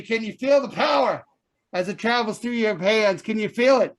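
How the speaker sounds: shouting preacher, rousing crowd; preaching at full force. Acted. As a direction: whip up the faithful loudly. Preacher whips up congregation.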